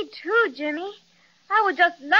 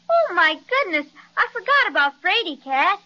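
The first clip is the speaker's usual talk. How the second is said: normal talking voice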